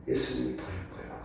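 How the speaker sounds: low, soft and fast; quiet awed echo; hushed repetition. Shown as a demonstration. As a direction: drop it to an awed hush. Preacher repeats line softly.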